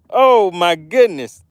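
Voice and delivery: usual speaking voice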